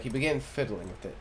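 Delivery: normal talking voice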